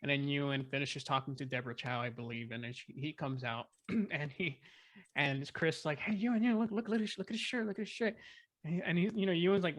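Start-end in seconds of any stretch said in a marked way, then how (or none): 6.0-8.1 high-pitched, urgent repeated nudging; imitated eager calling. Script coordinator getting the actor to look at a shirt, imitated eager calling. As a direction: pester him excitedly to look, in mimicry. Voicing Chris getting Ewan's attention.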